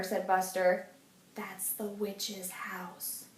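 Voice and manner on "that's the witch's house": slow, soft, spooky hush; hushed warning. A narrator's reading. Buster warns friends about the witch, slow and hushed.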